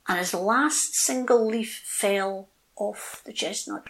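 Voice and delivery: ordinary delivery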